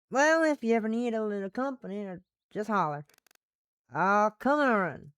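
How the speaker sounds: folksy Southern female voice; Southern woman. Acted. Offering company whenever they holler, in a Southern accent. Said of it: "one female Western Southern voice".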